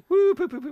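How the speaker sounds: high silly woo poo; silly high hooting. Pretend. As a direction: hoot it out high and goofy. Silly vocal noise.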